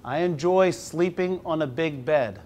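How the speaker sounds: slow, measured picture-book reading; reading to children. Acted. Teacher reading the book aloud slowly to the class. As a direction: read it slowly to five-year-olds.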